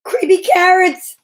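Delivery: high, loud terrified shout; shouted in fear. Acted. Jasper in bed shouting at the carrots, terrified. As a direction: yell it out in fright. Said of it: "he shouted".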